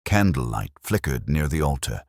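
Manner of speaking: ordinary delivery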